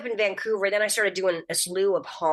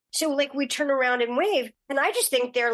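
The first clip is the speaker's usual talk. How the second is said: ordinary delivery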